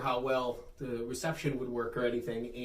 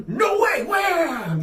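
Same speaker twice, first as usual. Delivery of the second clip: high, loud hammed-up cry; mock heroic shout; 8 dB louder, much slower than usual. Co-host swatting the cookie away, mock heroic shout. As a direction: burst out with a big retold rescue yell.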